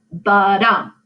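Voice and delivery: unstressed then stressed da-dum; iamb rhythm. Shown as a demonstration. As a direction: beat it out like a heartbeat. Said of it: "that's an iamb".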